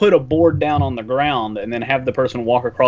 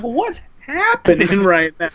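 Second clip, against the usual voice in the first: slowed, puzzled voice; bewildered rider; 4 semitones higher, slower than usual. Retold or imitated. Rider flying past neon lights, slow and puzzled. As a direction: sound lost and amazed. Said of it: "and you're like".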